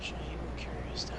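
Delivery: unvoiced sarcastic whisper; teasing whisper. Real, not acted. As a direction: whisper the jab under your breath. Teasing friend's tower excuse.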